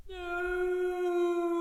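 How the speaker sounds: high, terrified no; horrified scream. Acted. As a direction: recoil in horror. Tenant screaming in horror.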